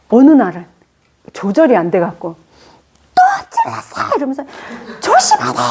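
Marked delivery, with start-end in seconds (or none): 3.1-4.1 much higher, laughing mock yell at the child; retold scolding. Mother snapping at the child who spilled the water, mock shriek. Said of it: "이러면서 팍 한번 두드려 팬다니까". 5.0-5.6 much higher mock scolding shriek; retold scolding. Mother scolding the clumsy child, high mock yell. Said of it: "이러면서 팍 한번 두드려 팬다니까".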